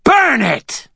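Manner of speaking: high shrieking command; shrieked. A narrator's reading. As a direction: shriek the order. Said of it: "Tessa shrieked".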